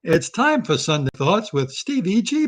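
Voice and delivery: slow, loud radio announcer greeting. Acted. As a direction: stretch it out like a show host opening. Opening a show like a host.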